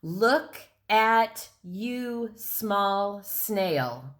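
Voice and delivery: "Look at you, small snail" in slow, separate beats; "Look at you, small snail" at half speed, one even beat per word; counted-out syllables. Shown as a demonstration. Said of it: "let's count out those syllables".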